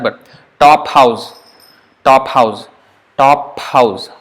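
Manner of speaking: "top house" said slowly, the p running into the h; slow model of "top house"; aspiration demo. Shown as a demonstration. Teacher giving an English example of aspiration, slow. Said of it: "English equivalent would be, top house".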